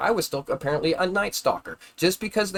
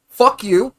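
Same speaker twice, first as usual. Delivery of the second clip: loud, higher angry outburst; real angry yell; furious shout; 6 semitones higher, 7 dB louder than usual. Real, not acted. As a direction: spit it out at them. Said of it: "that little rant".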